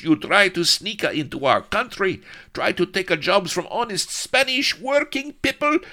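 put-on very high, loud, ranting Spanish accent; irate Spanish corporal. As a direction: rant indignantly at the foreign captives. Scowling Spanish corporal berates captives.

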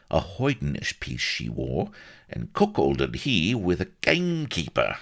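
high country voice, relishing the memory; reminiscent old rustic. A narrator's reading. Solomon recalls the squire's wedding, fond gossipy rustic voice. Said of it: "He chuckled reminiscently".

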